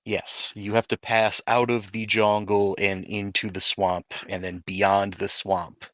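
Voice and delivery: usual speaking voice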